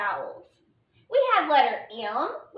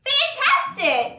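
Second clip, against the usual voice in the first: Fantastic, much higher, louder and slow, delighted; cheerful praise reading; 11 semitones higher, 4 dB louder, slower than usual. Shown as a demonstration. Teacher cheering the class on, bright and high. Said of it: "That was wonderful".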